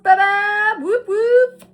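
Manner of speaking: very high, loud whooping cheer; excited cheer. Real, not acted. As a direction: celebrate out loud like you won. Celebrates a lucky card.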